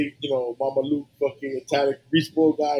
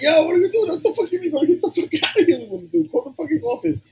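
coworker quote. Retold or imitated. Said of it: "And he's like".

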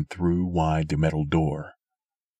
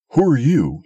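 loud, higher challenging shout from the cliff; 9 semitones higher, 8 dB louder than usual. A narrator's reading. Old man on the cliff shouting at Sim, loud and high. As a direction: yell down at the stranger. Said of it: "he shouted".

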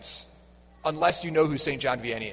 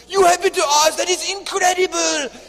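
put-on very high, loud French-accented amazement; hammed-up French accent, shouting in disbelief; outrageous French accent; 12 semitones higher, 9 dB louder than usual. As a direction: imitate a flabbergasted Frenchman, thick French accent. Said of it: "like, an outrageous French accent".

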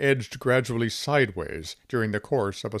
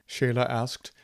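usual speaking voice

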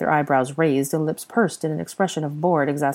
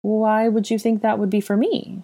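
mildly uneasy, higher-pitched question; higher, slightly uneasy query; 6 semitones higher than usual. A narrator's reading. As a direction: ask with a touch of nervous deflection. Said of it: "she asked uneasily".

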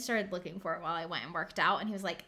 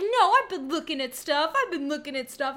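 lightly voiced whiny partner; mock defensive boyfriend; 5 semitones higher, 6 dB louder than usual. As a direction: play the lazy boyfriend protesting.

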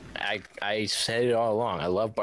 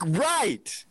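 heated shouting; 12 semitones higher, slower than usual. Real, not acted. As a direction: shout him down. Heated chip argument.

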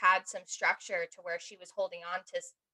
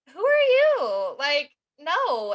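much louder and higher, slow, outraged; indignant retort; 7 semitones higher, 8 dB louder, much slower than usual. Retold or imitated. Her younger self snaps at Tiffany, loud, slow outrage. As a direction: fire it back at her, appalled. Snapping back at Tiffany, retold.

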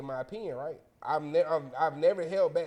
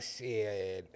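reluctant man; 8 semitones lower, 8 dB quieter than usual. Acted. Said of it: "And he like".

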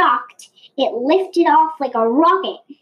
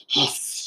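a long unvoiced snake hiss; 11 semitones lower, 6 dB quieter than usual. A narrator's reading. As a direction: hiss like a snake springing a trap. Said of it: "shouted Susie".

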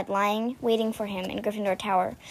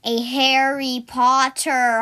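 usual speaking voice

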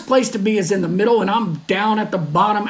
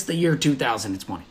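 ominous low; 9 semitones lower, 6 dB quieter than usual. Acted. Host warning podcast listeners about the year, ominous low and soft. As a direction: drop low and soft, full of doom.

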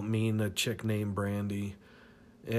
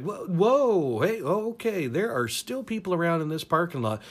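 alarmed protest; 6 semitones higher than usual. Retold or imitated. Startled protest in the car.

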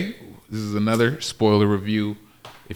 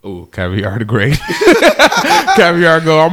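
tipsy; 6 semitones higher, 7 dB louder than usual. Real, not acted. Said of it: "I'm a little tipsy off the Patron".

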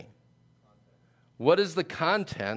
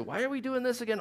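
lightly acted, higher-pitched wondering; puzzled questioner; 8 semitones higher, 4 dB quieter, faster than usual. Israelites wondering about their own laws, lightly puzzled. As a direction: ask it higher, as if mildly baffled.

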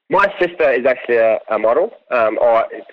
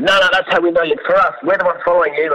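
higher-pitched voice of persistent fans; lightly mocked eager insistence; insistent fans; 8 semitones higher, faster than usual. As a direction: imitate them brushing off the objection. Friends insisting a second time.

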